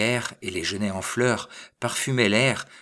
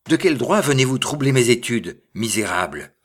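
loud, raised-pitch challenge; indignant cry; 4 semitones higher, 8 dB louder than usual. A narrator's reading. Little man climbing out at his disturbers, indignant cry. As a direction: cry out in outrage at the intruders. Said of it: "sortit en criant".

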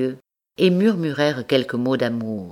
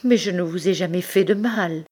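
tearful cry; 6 semitones higher than usual. Acted. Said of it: "cria-t-elle tout éplorée".

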